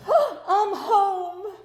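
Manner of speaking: high slow mock relief; mock sentimental. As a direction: ham up a dreamy homecoming.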